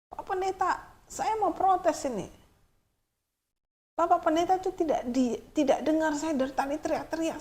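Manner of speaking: put-on high-pitched young man pleading his case, slowing down; a despairing youth begging the pastor to listen; young man complains to the pastor, high and pleading; desperate young man.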